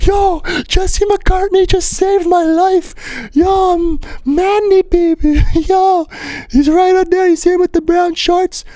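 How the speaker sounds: very high, loud, slow squealing voice; high squeaky thrilled voice; overexcited kid. Retold or imitated. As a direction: gush to your friend like a starstruck kid. Excitedly telling friend rescue.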